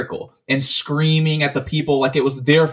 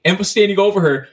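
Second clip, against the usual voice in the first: ordinary delivery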